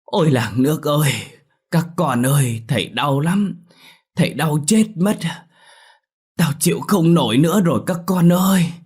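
put-on high, loud wail of an old man in agony; screaming in pain. A narrator's reading. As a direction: cry out to the whole village for help, acted at full force. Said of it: "cụ Mận la hét trong buồng ngủ".

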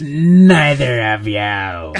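loud, slow, drawn-out bellow; mock-outraged, dragged-out dismissal; hammed-up refusal. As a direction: stretch NEITHER long and loud for comedy. Exaggerated replay of the refusal.

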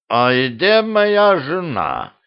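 put-on high, slow puzzled drunk question; frowning drunk king. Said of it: "нахмурил брови король Лир".

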